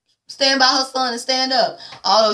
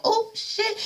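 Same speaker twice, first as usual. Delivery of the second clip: high, hushed "oh shit"; jaw-dropping surprise; shocked gasp; 11 semitones higher, 7 dB quieter than usual. Real, not acted. Shocked reaction.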